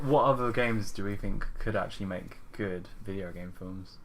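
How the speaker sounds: ordinary talk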